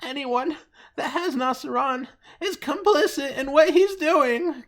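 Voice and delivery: very high, whiny mock wail; mock crying. Mocking a writer who's gotta cry online, mock crying. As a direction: wail it like a crybaby, drawing out the end. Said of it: "he's gotta cry".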